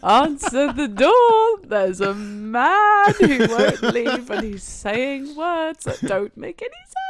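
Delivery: high, loud, drawn-out calling out; hammed-up plea, stretched and shouted; mock desperate yell. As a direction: call through a door, half laughing. Retells calling to friend behind door.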